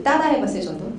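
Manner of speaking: usual speaking voice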